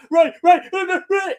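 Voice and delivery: high, loud repeated "Rey"; imitating him yelling for Rey from the quicksand; mock frantic calling. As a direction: call her name in a mocking panic. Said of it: "he's like".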